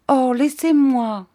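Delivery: high, slow, loud plea; imploring young sweetheart, pitched high; pleading. A narrator's reading. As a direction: beg to be left alone, drawing it out. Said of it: "dit-elle d'un ton suppliant".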